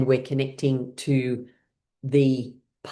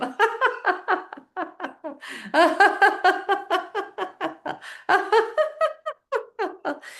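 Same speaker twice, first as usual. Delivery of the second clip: long high laugh. Real, not acted. Laughter yoga exercise.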